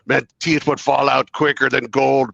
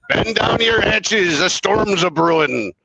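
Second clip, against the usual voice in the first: pirate voice; 7 semitones higher than usual. Retold or imitated. Weather service quoted as pirate.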